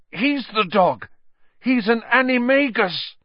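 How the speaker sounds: high, urgent, rising alarm; frantic warning. A narrator's reading. Injured Ron warning Harry, high and frantic. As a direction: warn him before it's too late.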